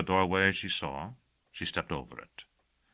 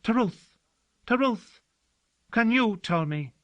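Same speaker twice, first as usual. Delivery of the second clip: much higher and louder, eager hope; a servant eagerly pleading with the girl for the truth; hopeful; 4 dB louder than usual. Acted. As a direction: beg eagerly, hope rising. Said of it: "Hope surged into his voice".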